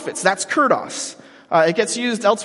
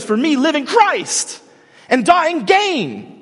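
slow, higher and louder, each word hammered; yelled; 9 semitones higher, 5 dB louder, slower than usual. Shown as a demonstration. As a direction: shout it out like an excited apostle. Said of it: "and he yells".

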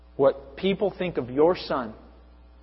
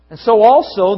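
ordinary delivery

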